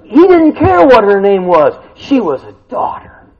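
ordinary talk

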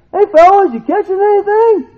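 cheery call. Retold or imitated. Jesus hailing the fishermen in the boat, cheery call. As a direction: shout out to the boat with a grin. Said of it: "He's like".

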